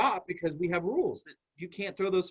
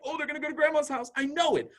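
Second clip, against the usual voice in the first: put-on high, fast, thrilled kid voice; a little kid sure of what comes next; excited child; 10 semitones higher than usual. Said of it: "so exciting for them to be like".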